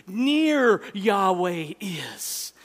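Near drawn out slow and loud; emphatic Hebrew order. Shown as a demonstration. Preacher showing the Hebrew emphasis, Near slow and loud. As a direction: lean hard on Near, slowly. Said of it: "It's emphatic in Hebrew".

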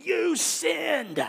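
high, loud shrieking accusation; screaming cat meme. Pretend. Preacher mocking Jesus as angry meme lady, loud shriek. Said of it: "screaming cat meme".

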